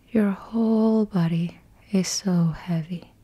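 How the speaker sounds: usual speaking voice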